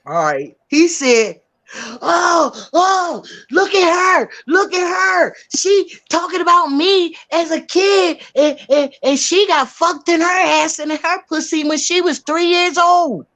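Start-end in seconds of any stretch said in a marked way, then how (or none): 2.0-13.2 high, raised mocking outcry; jeering imitation. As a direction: mimic him crowing to onlookers. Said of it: "This is how he said it".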